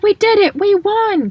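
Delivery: high-pitched, loud victory cheer; imitating a thrilled, triumphant shout; excited Ron. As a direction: burst out with the big win, voice way up. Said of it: "Ron is just so excited".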